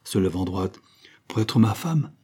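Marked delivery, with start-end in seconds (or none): none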